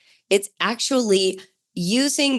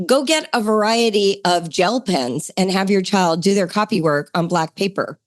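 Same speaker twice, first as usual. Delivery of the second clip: ordinary delivery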